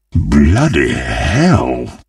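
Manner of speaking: low, very slow, drawn-out curse; heavy, low exclamation of defeat; dejected groan. Acted. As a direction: let it sink down in slow dismay. Second take, dejected.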